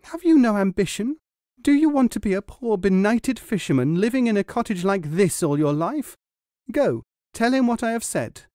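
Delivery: high, loud scolding wife; loud high nagging wife. A narrator's reading. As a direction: berate him for lacking ambition.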